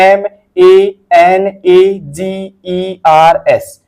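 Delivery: slow, one letter at a time; spelled out letter by letter. Shown as a demonstration. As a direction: read each letter slowly and separately. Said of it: "इसको पढ़ना है".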